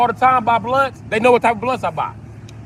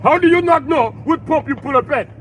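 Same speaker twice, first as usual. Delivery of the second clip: higher, louder mock African accent, exasperated; 6 semitones higher, 4 dB louder than usual. African cashier scolding a customer, mock African accent. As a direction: imitate the annoyed African cashier. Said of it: "An African dude will be like".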